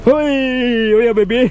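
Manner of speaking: high whooping call; joyful holler. Real, not acted. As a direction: whoop it out with glee. Calling out in scenery. (സന്തോഷത്തോടെ ഉറക്കെ വിളി)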